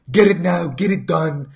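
clumsy attempt at a Scottish accent, punchy; hammy, self-admittedly awful Scottish accent. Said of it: "trying to speak in a Scottish accent".

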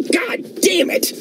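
high strained whispered scream of rage; frustrated scream. Acted. Alone after the turtle drives off, screaming in frustration. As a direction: let it all out in a breathless high scream.

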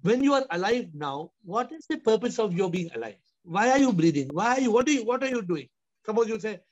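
ordinary talk, nothing marked

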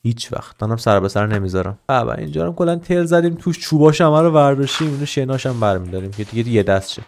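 ordinary delivery

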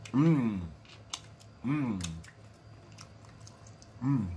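low contented mmm; savoring hum. Real, not acted. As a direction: hum over a good bite. Tasting food.

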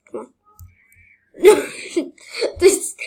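ordinary delivery

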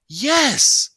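very high, loud defiant yell; yelled yes. A narrator's reading. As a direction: shout it back at her. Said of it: "Byron yelled back".

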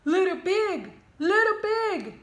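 high, loud wolf voice; wolf's polite call. Acted. Wolf calling politely at the pig's door. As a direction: call sweetly through the door. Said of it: "knocked politely on the door and said".

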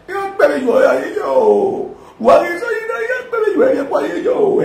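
very high-pitched mock wail. Mockingly wails a drawn-out cry.

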